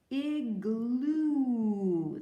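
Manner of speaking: "Igloo" said loudly with the final "oo" drawn out; stretched long oo. Shown as a demonstration. Teacher modeling igloo's ooh sound for kids. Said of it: "There's that ooh sound".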